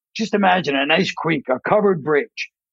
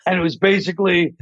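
ordinary delivery

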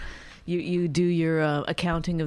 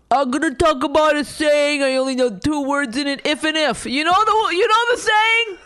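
high, loud mocking voice of a clueless rambler; mocking impression; 9 semitones higher, 5 dB louder than usual. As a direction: ham it up, squeaky and loud, making fun of him. Mocking his rambling about sayings.

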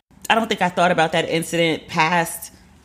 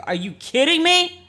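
much higher, slowed, drawn-out incredulity; outraged disbelief; 12 semitones higher than usual. Retold or imitated. Reacting to the hostess's promo email, high drawn-out disbelief. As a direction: let the outrage climb high and stretch.

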